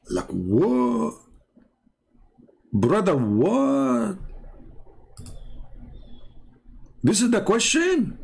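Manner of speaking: drawn-out high disbelief; mock disbelief. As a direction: scoff at the absurd question. Mocking a question he reads.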